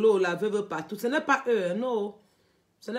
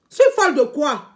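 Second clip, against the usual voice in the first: louder indignant repeat; 4 semitones higher, 6 dB louder than usual. Real, not acted. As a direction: repeat it louder, more offended.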